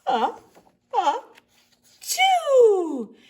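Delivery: building ah-ah then loud high choo; big sneeze. Acted. Bear sneezing from pepper, loud sneeze. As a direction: hold it then explode the sneeze.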